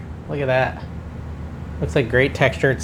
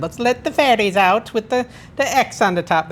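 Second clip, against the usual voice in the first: put-on playful Irish accent, pitched higher; higher-voiced amateur Irish accent; 5 semitones higher than usual. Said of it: "accent, my Irish accent".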